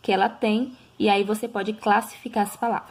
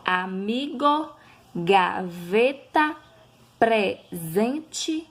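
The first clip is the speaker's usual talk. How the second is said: amigo and gaveta drawn out syllable by syllable for pupils; slow syllable by syllable; much slower than usual. Shown as a demonstration. Teacher to pupils, slow syllable-by-syllable example words. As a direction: spell out each syllable slowly.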